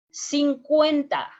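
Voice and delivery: slow, emphatic cincuenta; stressed number. Shown as a demonstration. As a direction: lean on cincuenta to teach it. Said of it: "teacher stresses number for learners".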